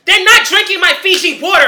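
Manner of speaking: high, very loud comic protest; indignant outburst. Real, not acted. Friend refusing to share water with future kids, indignant yell. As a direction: yell it, outraged and half laughing.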